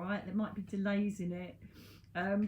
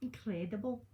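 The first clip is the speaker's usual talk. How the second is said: put-on Scottish accent. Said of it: "doing a Scottish accent".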